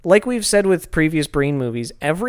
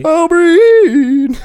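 long high yell, stretched slow; drawn-out shout; 12 semitones higher, 9 dB louder, much slower than usual. Pretend. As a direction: belt the word out long and high. Excited shout of the name.

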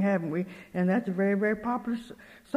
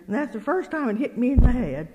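usual speaking voice

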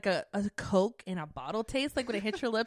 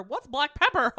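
laughing; 4 semitones higher, 8 dB louder than usual. Retold or imitated. Imitating family's bland cooking.

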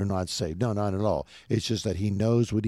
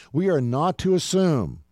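raised, emphatic push on "We are not to assume"; 6 semitones higher, 3 dB louder than usual. Real, not acted. Preacher rereading a verse he finds powerful, emphatic. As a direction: drive home "not to assume" with higher pitch. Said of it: "four or five exclamation points there".

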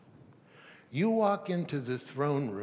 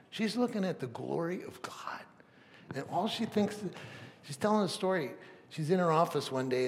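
normal talking voice

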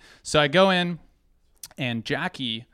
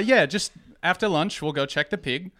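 higher New Zealand coworker voice; pitched-up New Zealand accent; 4 semitones higher, 3 dB louder than usual. Acted. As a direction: put on an amateur Kiwi accent. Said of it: "oh, New Zealand accent".

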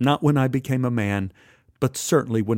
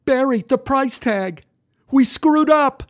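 put-on high, panicky teen voice; panicked; 13 semitones higher, 5 dB louder, slower than usual. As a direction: blurt out the bad news. Boy panicking over price tags.

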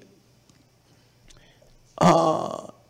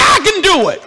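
shouted call to repeat; 7 semitones higher than usual. Shown as a demonstration. Leading congregation in chant.

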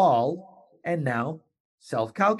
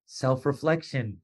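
ordinary talk

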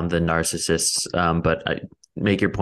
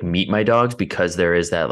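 ordinary talk, nothing marked

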